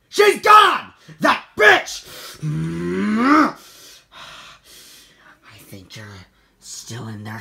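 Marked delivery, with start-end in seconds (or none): 0.1-2.1 high, loud shocked yell; angry outburst. Acted. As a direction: blurt it out, outraged. Woman took family.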